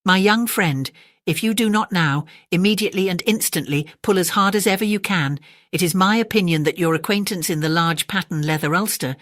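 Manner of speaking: ordinary delivery, nothing marked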